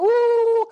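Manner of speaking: high, loud whooping holler at the sheep; retold holler. Preacher recalls hollering at sheep in Israel, loud high whoop. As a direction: call out high to catch their attention. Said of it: "Every kind of holler I go".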